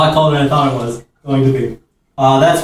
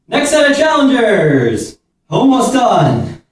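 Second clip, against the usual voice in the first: ordinary talk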